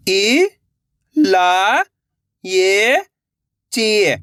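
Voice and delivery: each syllable said alone, rising. Shown as a demonstration. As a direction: sound out इ, ला, ये, ची one at a time with a pause. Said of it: "पहले इलाय पढ़ो". (अक्षर अलग-अलग बोलना)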